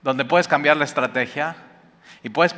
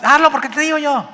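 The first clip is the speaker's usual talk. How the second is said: much higher, louder mock command; bossy parent; 12 semitones higher, 4 dB louder than usual. Preacher mocking a parent's lazy order, higher and louder. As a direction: snap it like an impatient parent. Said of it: "lo más fácil para los papás".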